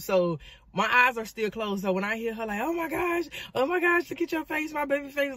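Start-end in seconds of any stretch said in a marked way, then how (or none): 2.6-5.3 high-pitched panicking mama; mock frantic wail over her child; imitated freaking out. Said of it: "like, she's freaking out".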